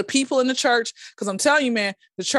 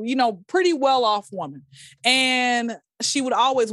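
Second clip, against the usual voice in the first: ordinary talk, nothing marked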